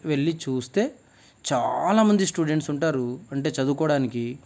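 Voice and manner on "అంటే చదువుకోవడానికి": usual speaking voice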